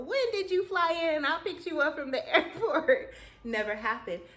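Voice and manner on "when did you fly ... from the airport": high, loud, laughing "when did you fly in"; teasing a friend who shouldn't be there; excited laughing disbelief. Retold or imitated. Teasing sister in dream, laughing.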